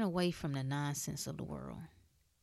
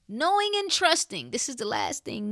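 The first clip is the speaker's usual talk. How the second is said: high, ringing proclamation; preached emphasis; 8 semitones higher, 5 dB louder than usual. Acted. To the congregation about faith, a ringing high call. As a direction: lift it like a sermon peak.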